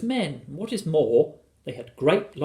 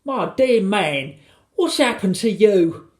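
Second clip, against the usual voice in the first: high, loud wifely alarm; loud, high, drawn-out exclamation; alarmed cry; 8 semitones higher, 7 dB louder, slower than usual. A narrator's reading. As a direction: cry out in shocked disbelief. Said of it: "cried Missus Gregg".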